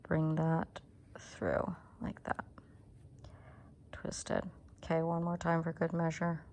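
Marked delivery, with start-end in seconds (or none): 0.1-4.4 whispering. Real, not acted. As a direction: murmur it while focused on hands. Concentrating on tricky stitch, whispers.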